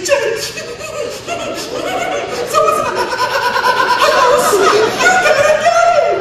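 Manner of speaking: high, loud, slow panicked squeal; mock terrified wife, voice way up. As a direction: shriek at him in terror. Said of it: "She's like".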